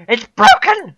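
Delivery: very high, loud outburst; frustrated yell. Real, not acted. Streamer finds the game broken, frustrated yell. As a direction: scream it in exasperation.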